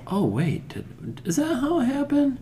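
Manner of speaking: high, puzzled double take; confused surprise. Retold or imitated. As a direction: ask it in sudden confusion. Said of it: "to know".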